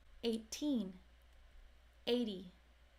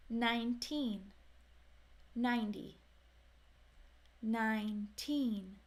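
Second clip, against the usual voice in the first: ordinary talk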